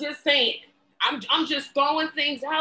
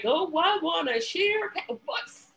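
higher, slow mock quote; mocking imitation; 6 semitones higher, slower than usual. Commentator imitating the party leader's power sharing, mockingly. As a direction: mimic a too-eager sharer, drawn out and higher. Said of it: "he's just like".

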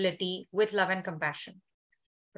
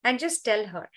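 guided meditation; 2 semitones lower than usual. Acted.

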